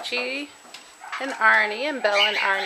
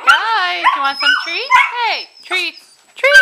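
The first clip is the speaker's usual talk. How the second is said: excited pet voice; 11 semitones higher, 6 dB louder than usual. Real, not acted. Offering puppies treats.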